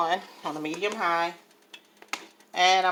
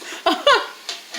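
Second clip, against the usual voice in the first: sudden high breathy laugh; delighted laugh; 4 dB louder than usual. Real, not acted. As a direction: burst out laughing. Delighted at tasting food.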